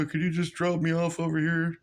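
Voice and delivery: a light imitation of a pushy passenger; mock customer voice. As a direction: play the guy casually asking a favour. Quoting the pushy stranger.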